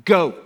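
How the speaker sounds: a single firm command word; emphatic go. Shown as a demonstration. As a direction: Go punched loud and short.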